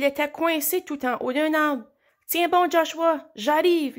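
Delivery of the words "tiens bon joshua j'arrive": higher, louder call up to a friend. A narrator's reading. Lou calls up to Joshua stuck in a tree, shouting. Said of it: "lui cria Lou".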